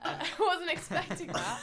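words squeezed out between laughs; real laughter. Real, not acted. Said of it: "take a break to laugh there".

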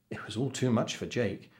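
ordinary delivery, nothing marked

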